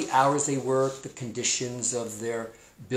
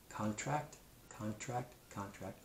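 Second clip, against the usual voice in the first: each repeat softer, fading to a hush; 14 dB quieter than usual. Shown as a demonstration. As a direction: say it three times, letting it fade away. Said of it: "let's put it in our brain three times".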